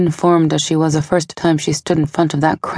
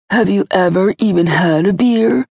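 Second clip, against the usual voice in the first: whiny sneer; 4 semitones higher than usual. Acted. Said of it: "sneered in a whiny voice".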